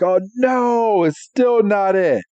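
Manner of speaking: high-pitched wail of dismay; hammed-up distressed lament; mock distress. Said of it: "it was in such distress".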